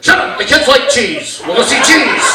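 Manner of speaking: dad barking back at mom in the car; dad snapping. Retold or imitated. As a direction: shut her down like a stubborn driver.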